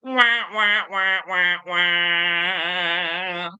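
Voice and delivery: high mock sad-trombone wah wah wah, falling; vocal sad trombone to cap the joke; mouth-made wah wah trombone sting. Sad trombone after joke punchline.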